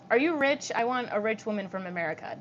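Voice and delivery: usual speaking voice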